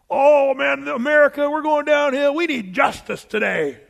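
loud, high mock outrage about the nation going downhill. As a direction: ham up a panicked churchgoer demanding justice. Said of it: "If you say that".

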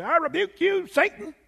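high theatrical mock rebuke; TV evangelist. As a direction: ham up a showy TV preacher rebuking Satan. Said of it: "the T V evangelist guys who say".

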